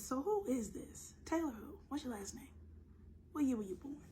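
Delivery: high-pitched mock interrogation; suspicious questioning. Plays herself quizzing the mystery caller.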